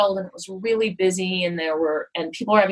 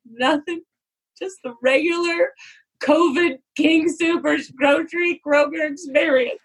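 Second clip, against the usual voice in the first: high, airy fake-casual reply; forced breezy brush-off; 9 semitones higher than usual. Brushing off her worried husband, falsely breezy. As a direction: brush it off as if nothing's wrong, pitched up and slowed. Telling husband nothing happened.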